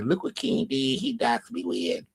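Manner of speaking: lightly voiced whining accuser; mocking whiny voice. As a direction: mimic him tattling, whiny. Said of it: "He would have been telling everybody".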